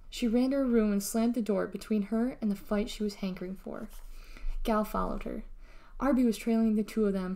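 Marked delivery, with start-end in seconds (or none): none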